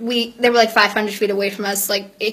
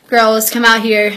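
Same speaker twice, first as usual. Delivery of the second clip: loud dad calling, slow; 11 dB louder than usual. Retold or imitated. Dad calls daughters out of the bathroom, calling out. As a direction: call the girls out, much louder. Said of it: "calls out to us".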